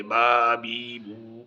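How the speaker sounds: syllables spelled out very slowly, one by one; very slow forward reading drill. Shown as a demonstration. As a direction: drag each syllable out for the child. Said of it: "یہ بھی الٹا سیدھا سارا آنا چاہیے".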